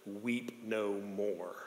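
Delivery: very slow, very soft comfort; barely audible slow consolation; tender elder. Retold or imitated. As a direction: draw each word out gently. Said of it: "the elder leans over and says".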